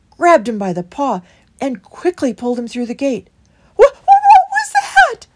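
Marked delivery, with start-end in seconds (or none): 3.8-5.3 very high, loud, stammering mouse; alarmed squeak. A narrator's reading. Christopher startled by the swooping shape, panicked high squeak. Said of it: "squeaked Christopher in alarm".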